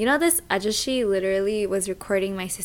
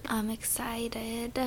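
breathy slow whisper; 9 dB quieter, much slower than usual. Real, not acted. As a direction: whisper it under your breath. Quiet aside about trip.

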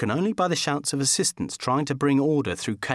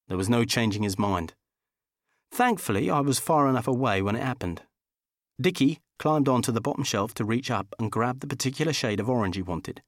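ordinary delivery, nothing marked

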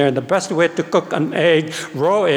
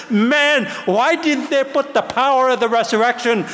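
much higher, eager cry; excited exclamation; 6 semitones higher than usual. Retold or imitated. Reader who just found the resurrection, high and excited. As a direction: blurt it out in amazed frustration. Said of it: "I said".

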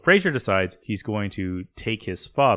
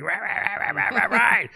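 high, rapid-fire nagging noise; imitated scolding gibberish; 11 semitones higher than usual. Imitating wife's garbled scolding.

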